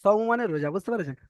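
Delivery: sing-song spelling of সৌমু; recital drill. Shown as a demonstration. Teacher answering a student by chanting.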